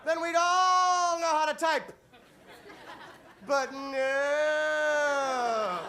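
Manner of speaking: high, loud, slow shout; ranting comic shouting about typewriters. Acted. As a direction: bellow the punchline.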